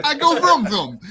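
high, loud laughing vroom. Pretend. Co-host laughs and mimics a revving car. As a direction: play the excited car fan. Mock car noise joke.

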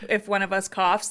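ordinary talk

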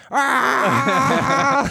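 mock burp shout. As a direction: belt out a gross burp sound. Mocking Red Zone theme.

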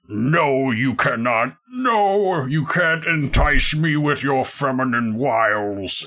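strained villain, drawn out at the end; resisting villain. Acted. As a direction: fight off temptation in a put-on voice. Game master voices villain resisting seduction.

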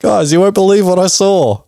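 high, excited mock astronomer; hammed-up high-pitched eyewitness announcement; excited witness. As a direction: burst in with astonishing news.

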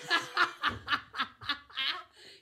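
loud, drawn-out laughter; real belly laugh; long laughing fit. Real, not acted. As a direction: crack up at the joke. Cracks up at tax joke.

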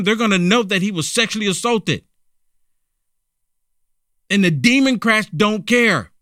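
high-pitched, exasperated challenge; worked-up indignant commentary. Real, not acted. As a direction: argue it in rising disbelief, pitched well up. Indignant rant defending the victim.

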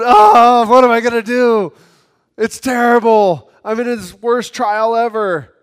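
high, loud fretful moan; worried whining. Pretend. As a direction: moan as if every trial is the worst. Preacher acts out a worried believer.

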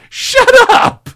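high, slow mock yell of outrage. Ranting at the fan, high drawn-out mock yell. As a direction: stretch it out in disbelief.

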